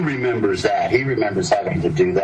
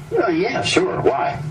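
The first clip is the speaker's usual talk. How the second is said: much higher, puzzled reply; a touch of naive surprise; innocent surprise; 10 semitones higher, slower than usual. Retold or imitated. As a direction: answer with clueless surprise. Retold innocent reply to warning.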